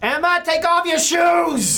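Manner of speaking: high, loud mother yelling through the house; shouting mother. Acted. Mother hollering at Emma to take off her shoes, shouting. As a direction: holler at your daughter, stretched out. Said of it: "Stop shouting".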